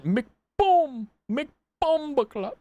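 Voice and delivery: high, drawn-out mock Jamaican; Jamaican accent. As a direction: stretch it in a playful Jamaican accent. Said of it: "Maybe Jamaican".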